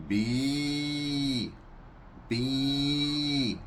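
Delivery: soft bleating bi bi; Russian sheep bleat. Shown as a demonstration.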